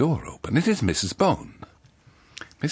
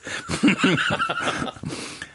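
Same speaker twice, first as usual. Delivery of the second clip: squeaky giggle; high giggle. Real, not acted. Narrator cracks up.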